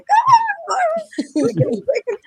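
high, hammed-up girlish "come on"; mock the friends tottering behind; imitating whiny friends. Girlfriends on the fire escape, mock high whine. Friends nagging as they climb.

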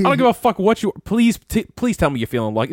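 loud, high taunting challenge; taunting shout. Retold or imitated. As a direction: dare him to swing. Retold taunt at big guy.

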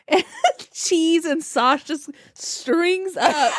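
slow, laughing through the words; can barely get it out for laughing; telling a funny mishap, laughing hard. Real, not acted.

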